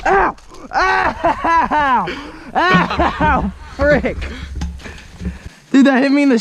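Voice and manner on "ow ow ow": sharp laughing ows; pained yelps. Real, not acted. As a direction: yelp as you get hit. Hit by airsoft pellet.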